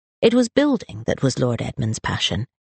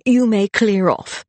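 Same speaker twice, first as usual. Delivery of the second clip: slow, higher, emphatic order; indignant, drawn-out command; cross dismissal; 7 semitones higher, 4 dB louder, slower than usual. A narrator's reading. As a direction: send him packing. Sister orders brother out.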